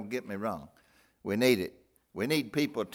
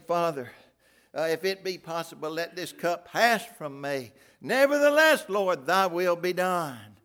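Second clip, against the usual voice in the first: anguished prayer; 6 semitones higher, 8 dB louder than usual. Acted. Jesus praying in garden.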